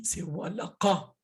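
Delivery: walaqa with the q from the throat, slowly; walaqa, the q pulled from deep in the throat. Shown as a demonstration. Teacher correcting walakabi to the deep q. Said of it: "c'est pas walakabi".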